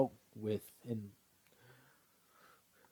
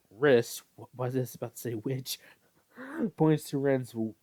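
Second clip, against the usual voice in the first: ordinary delivery, nothing marked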